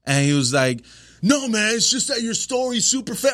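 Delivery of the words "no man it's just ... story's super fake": high, insistent protest; heckler's voice. Retold or imitated. Heckler explaining himself to comic, high insistent protest. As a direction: argue it in a raised pitch.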